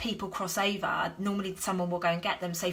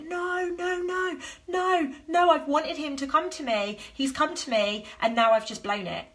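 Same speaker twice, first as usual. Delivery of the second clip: high, panicky pleading no, no, no; mock-wail about blowing the chance; panicky self-pity; 7 semitones higher than usual. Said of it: "victim mode".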